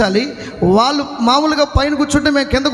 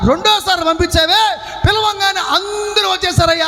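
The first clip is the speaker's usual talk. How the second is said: raised, higher preacher's call to the crowd; fiery preaching; 6 semitones higher than usual. Acted. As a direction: preach it out with full force to the congregation. Preaching at full force.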